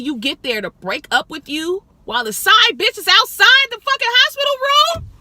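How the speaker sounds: building from disbelief to a loud, high indignant cry; incredulous outrage. Retold or imitated. Friend reacting to the breakup story, rising to an outraged yell.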